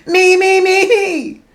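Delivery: very high, loud imperious demand; a greedy toddler bellowing for a crumpet; booming toddler. Acted. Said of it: "imperious hand and boomed".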